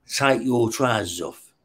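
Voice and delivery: a slow, soft, menacing imitated cop order; a cop orders the prisoner, slow and quiet. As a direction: drawl the command softly like a cop. Cop gives an order in the cell.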